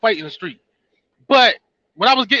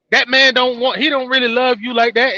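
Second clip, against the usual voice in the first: ordinary talk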